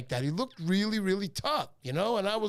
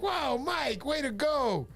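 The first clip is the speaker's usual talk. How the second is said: higher, bright mock cheer; excited praise for Mike, higher; delighted; 7 semitones higher, slower than usual. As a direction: retell your own happy surprise. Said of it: "I was like".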